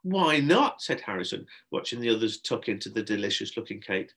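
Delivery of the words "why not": put-on high, loud boyish demand; indignant protest. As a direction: challenge it crossly. Boy protests being refused cake.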